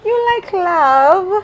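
high, drawn-out "aw"; teasing coo. Real, not acted. Interviewer teasing the young singer about love, teasing coo. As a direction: coo it slowly, stretching "love".

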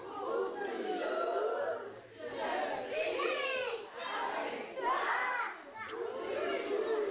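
high, soft and breathy; mock high falsetto. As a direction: pretend to sing along in a high thin voice. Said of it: "je ferai semblant".

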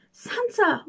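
high alarmed call of a name; cry out. Acted. William calling to Santa, high alarmed cry. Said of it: "William cried".

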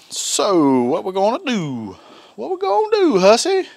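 sudden high singsong on do and hussy; playful singsong. Real, not acted. Cook teasing himself as Hungry Hussy, high playful singsong. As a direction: sing it up to yourself, teasing.

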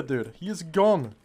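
ordinary talk

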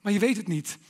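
ordinary talk, nothing marked

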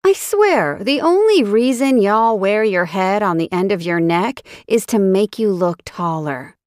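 growling scorn. A narrator's reading. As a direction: snap at the clueless man with contempt. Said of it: "she growled".

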